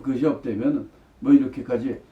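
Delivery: ordinary talk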